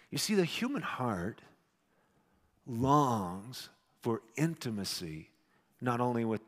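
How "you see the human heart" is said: normal talking voice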